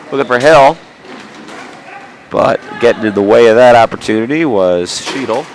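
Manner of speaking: ordinary talk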